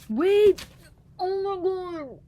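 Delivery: high, loud startled cry; sudden shock. Real, not acted. Finding her forgotten drawings, loud high shocked cry. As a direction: shout it as you spot something.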